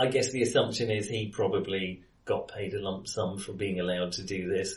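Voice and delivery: usual speaking voice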